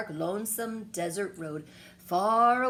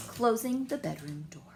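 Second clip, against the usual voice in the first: usual speaking voice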